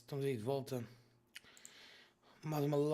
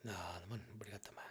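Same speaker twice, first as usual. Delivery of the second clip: whispering; 6 semitones lower, 11 dB quieter, faster than usual. Acted. Answers friend's thanks.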